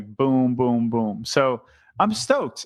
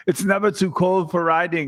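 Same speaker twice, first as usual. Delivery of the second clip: light mock Austrian-German Schwarzenegger accent; a quick, playful Austrian-German accent in the style of Schwarzenegger; 3 semitones higher than usual. Guy quoting a tough cycling pro in a mock Austrian-German Schwarzenegger accent. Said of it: "I went into my Schwarzenegger, um, accent".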